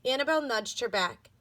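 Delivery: ordinary delivery, nothing marked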